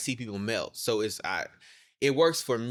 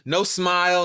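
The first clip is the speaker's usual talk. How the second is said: usual speaking voice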